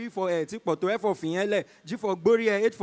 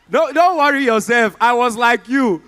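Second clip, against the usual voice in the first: loud reassurance; 3 semitones higher, 10 dB louder than usual. Real, not acted.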